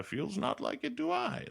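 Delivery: high, rising Yoda speech; mock Yoda, backwards and high. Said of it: "says it all weird and backwards and Yoda-like".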